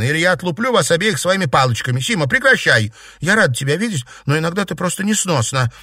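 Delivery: scold, easing into reproach; exasperated scolding. Acted. Cousin scolding the two quarrelling girls, exasperated.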